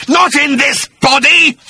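high, loud, slow monster screech; screeching creature. Acted. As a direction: screech it as a furious bat-beast. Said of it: "screeched the creature".

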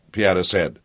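ordinary delivery

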